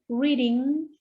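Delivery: ordinary talk, nothing marked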